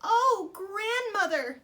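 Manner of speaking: put-on girl realising grandmother is the wolf; alarmed girl. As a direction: stretch it out in growing fear. Girl alarmed by wolf's teeth.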